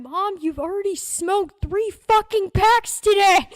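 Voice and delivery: hammed-up shout from another room; exasperated son. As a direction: yell back at mom, fed up. Son yells back at mother.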